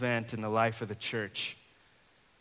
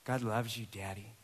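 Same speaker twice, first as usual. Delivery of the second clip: under her breath; 3 semitones lower, 6 dB quieter than usual. Retold or imitated. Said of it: "under her breath".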